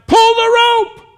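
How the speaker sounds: high, loud, slow shout, x0.6; the rescuer in the river shouting to the crowd on shore; hollered out. Retold or imitated. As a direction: holler it with all your strength. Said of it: "hollered out".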